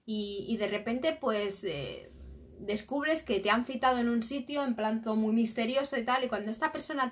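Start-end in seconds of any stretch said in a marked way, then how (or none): none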